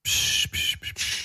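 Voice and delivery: urgent whispered psst; shushing. Shown as a demonstration. Hushing his co-host's awkward question in a whisper. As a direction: hiss them quiet. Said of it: "hast du immer noch Tripper".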